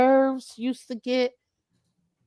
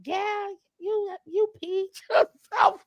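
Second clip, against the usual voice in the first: teasing sister; 9 semitones higher, 4 dB quieter than usual. Retold or imitated. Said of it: "she like".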